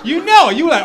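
high, loud laughing rush; cracking up while telling it; laughing excitement. Retold or imitated. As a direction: lean in, laughing hard.

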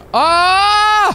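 sudden high, loud yelp of alarm; a sharp Ah! as the car nearly crashes; startled yell. Real, not acted. Sudden game mishap.

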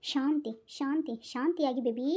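slow, soft calming hush; wife hushing her sulking husband, slow and soft; soothing. Acted. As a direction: settle him down gently.

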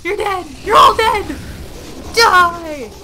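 high, loud taunting shouts; gleeful full-volume kill cries; battle yell. Real, not acted. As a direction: yell it at the enemies. Yelling at enemies mid-fight.

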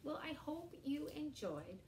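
ordinary talk

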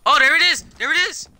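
high, loud cheer; excited shout. Real, not acted. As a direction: yell it out in triumph. Finds the item, cheering.

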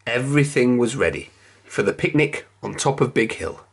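plain reading voice

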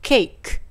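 ケーク as "cake", ending in a released k; ケーク closed on the k sound; English cake pronunciation. Shown as a demonstration. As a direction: say ケーク with a final k, not "ki". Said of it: "Kの発音はクっていう発音だから".

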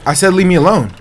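loud and high; insistent yell. Real, not acted. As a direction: yell it as a firm warning. Player yelling at opponents.

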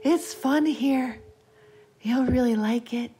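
put-on eerie child beckoning; luring child voice. A narrator's reading. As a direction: draw it out high to lure a playmate. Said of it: "the voice said".

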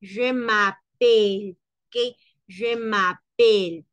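"Je m'appelle" slow and firm; slow model. Shown as a demonstration. Teacher models "Je m'appelle" slowly. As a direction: say it slowly and firmly. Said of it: "sino únicamente m'appelle".

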